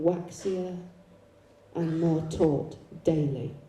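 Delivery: measured poetry recital with long pauses; solemn, spaced-out performance reading; spoken-word poem. Acted. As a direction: recite it deliberately, line by line. Recites own piece about friend.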